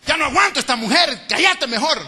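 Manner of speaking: a husband snapping at his wife; angry father. Retold or imitated. Father scolding wife before kids.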